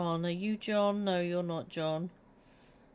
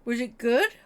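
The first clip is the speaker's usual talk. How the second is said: louder, rising question to a cat; pet talk; 2 semitones higher, 7 dB louder than usual. Real, not acted. Asks the cat, high rise.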